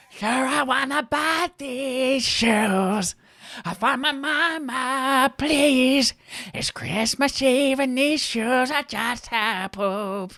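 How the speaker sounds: high, slow, lisping singing; [name] impression. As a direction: croon it mournfully with a lisp. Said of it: "I have to get my [name]".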